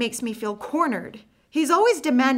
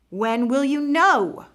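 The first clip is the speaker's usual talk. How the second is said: slow, pressing question, louder; restrained anger; 4 semitones higher, 6 dB louder, slower than usual. Acted. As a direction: hold the anger back, push each word. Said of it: "Jonathan is getting angry".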